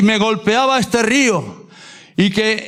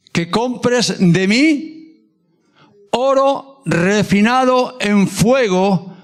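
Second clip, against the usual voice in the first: very slow, weighty words; slow solemn quote; much slower than usual. Acted. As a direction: let each word of the divine counsel land. Quoting God's counsel, drawn out.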